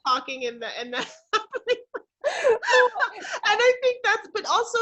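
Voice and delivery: high laughter with a catch of tears; laughing and crying. Real, not acted. Guest to host, laughing and half crying about grief. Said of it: "I'm so glad that we're laughing".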